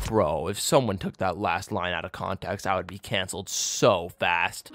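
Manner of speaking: soft, low, slow sheepish aside. Real, not acted. Vlogger backpedalling on an awkward line, low and soft. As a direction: mutter it half to yourself.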